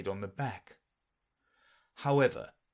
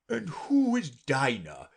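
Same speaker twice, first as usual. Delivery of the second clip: slow, loud, higher question; inquiring Lory; 4 semitones higher, 5 dB louder, slower than usual. A narrator's reading. Lory asking Alice about Dinah, slow and loud. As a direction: ask slowly and grandly.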